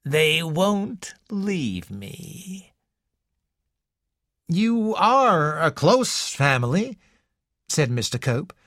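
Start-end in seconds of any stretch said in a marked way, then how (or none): 0.0-2.7 put-on sly smiling old woman telling the visitor; strange smile. A narrator's reading. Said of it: "with a strange smile". 4.5-7.0 unsure. A narrator's reading. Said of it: "his voice sounded unsure".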